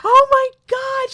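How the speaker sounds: overwhelmed mock sob; retold sob. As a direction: relive the sister breaking down. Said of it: "she started sobbing".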